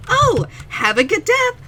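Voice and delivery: sugary gas-station farewell; customer service voice. Retold or imitated. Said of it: "the attendant standing there is like".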